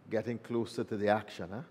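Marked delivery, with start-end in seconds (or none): none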